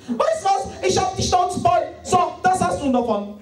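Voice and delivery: high, loud sing-song huffy wife. Retold or imitated. The wife in a fight, huffy high sing-song. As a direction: flounce off like a sulky wife.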